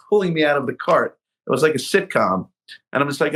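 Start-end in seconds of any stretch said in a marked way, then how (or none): none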